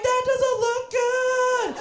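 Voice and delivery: high, loud drawn-out wail; panicked cry. Retold or imitated. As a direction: cry out in alarm, stretching the words. Acted panic while falling.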